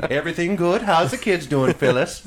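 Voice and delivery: high, loud mock Canadian accent; hammed-up friendly Canadian checking in; playful Canadian lizard neighbour. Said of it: "It's very Canadian of them".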